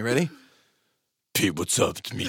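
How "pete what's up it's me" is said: very low, soft, muffled mock voice; impression of Robert. Host imitating his co-host imitating him, low mumbled impression. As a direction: drop way down and mumble it indistinctly. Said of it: "Let me imitate Robert".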